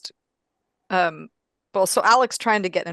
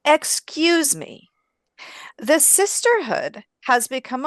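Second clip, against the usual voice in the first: slow, higher, deliberately stately delivery; grand formal Martha; 4 semitones higher, slower than usual. Acted. Nun stops a boy to ask after a writer, slow and stately. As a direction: address him with slow, weighty importance. Player voices Martha addressing a stranger.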